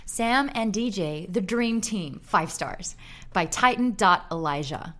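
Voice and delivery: put-on Australian doing an American accent. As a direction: read it in a cheery American accent. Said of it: "I'm gonna do it in my American accent".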